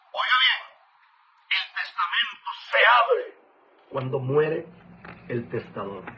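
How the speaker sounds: loud, fervent preaching; preaching at full force. Acted. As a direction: hammer each phrase at the congregation. Preaches loudly to congregation. (predicación a todo pulmón, con fervor)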